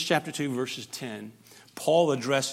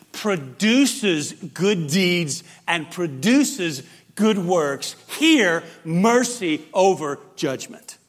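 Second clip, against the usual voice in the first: louder, rising preacher's cry of conviction; full-force preaching; 3 semitones higher, 7 dB louder than usual. Acted. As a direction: preach it out with building fervor to the church.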